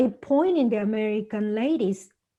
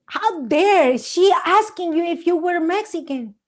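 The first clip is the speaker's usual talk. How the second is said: imitating an offended man, higher pitched; mock outrage; 7 semitones higher, 4 dB louder than usual. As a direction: act out his indignation on her behalf. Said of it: "He was so in fire".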